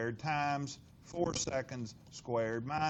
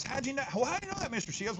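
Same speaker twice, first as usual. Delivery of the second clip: higher whiny student questioning; 9 semitones higher, faster than usual. Retold or imitated. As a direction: play the skeptical student. Teacher voices a doubting student.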